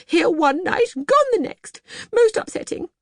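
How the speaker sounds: fighting back tears; gulping. Acted.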